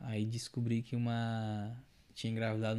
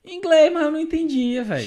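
excited loud; 12 dB louder than usual. Real, not acted. Laughing at own confusion.

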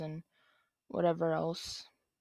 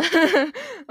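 loud laugh; 11 semitones higher, 14 dB louder than usual. Real, not acted. Laughs after naming mom.

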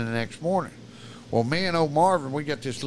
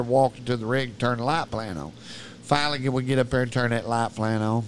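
ordinary delivery

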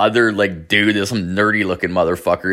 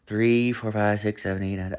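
fading count; 8 dB quieter than usual. Retold or imitated. Drunkenly counting beers, fading.

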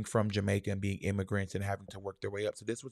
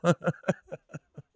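laugh; 8 semitones higher, 7 dB louder than usual. Real, not acted. Laughter after joke.

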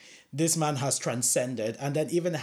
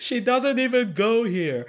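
higher, slow, louder mock outrage through a laugh; Mean Girls quote; 9 semitones higher, 5 dB louder, slower than usual. Joking about watching Mean Girls, mock outrage. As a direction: deliver it like the movie's famous outburst. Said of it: "during Mean Girls".

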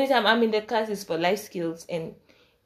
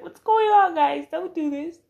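much higher and louder, near crying; mock panic; 10 semitones higher, 6 dB louder than usual. Calling after departing friends, panicked near-crying plea. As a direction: plead in a panic as they leave.

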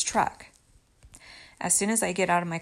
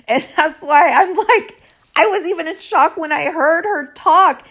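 higher, louder, through laughter; laughing; 9 semitones higher, 9 dB louder than usual. Real, not acted. Storyteller explains her shock at that accent, laughing. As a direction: laugh while explaining yourself.

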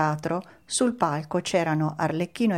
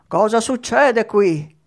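loud, slow, drawn-out roar; angry bellow; 5 semitones higher, 8 dB louder, slower than usual. A narrator's reading. Mangiafuoco breaking up the commotion, loud slow bellow. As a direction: bellow like a fearsome puppet master. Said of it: "Mangiafuoco urlò".

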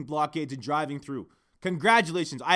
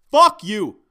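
much louder final curse; angry yell; 4 semitones higher, 13 dB louder than usual. Real, not acted. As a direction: spit it out as a real loud outburst. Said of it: "I'm gonna keep yelling".

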